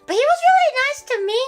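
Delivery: gushing quickly; excited. Real, not acted. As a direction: burst out with it. Sudden high fast line.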